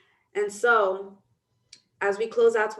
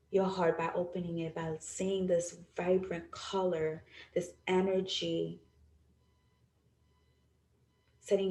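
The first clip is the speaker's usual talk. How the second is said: guided meditation; 4 dB quieter than usual. Acted. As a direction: guide them gently through the visualization. Leads a guided visualization.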